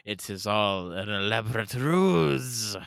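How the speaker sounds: slow, loud mock-European accent; hammed-up vague European accent for a grand reveal; put-on European accent. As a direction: stretch it out in a silly foreign accent. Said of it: "I really enjoy that accent you were doing".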